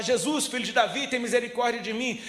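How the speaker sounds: a retold desperate plea, voice lifted. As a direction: call out over the crowd. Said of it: "mais alto ele gritava".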